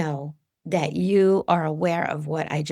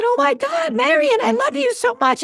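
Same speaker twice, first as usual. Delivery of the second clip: high, loud joyful call to a bird; calling out in delight to her friend's spirit; overjoyed cry; 5 dB louder than usual. Retold or imitated. Greeting the screaming bird as her lost friend, overjoyed.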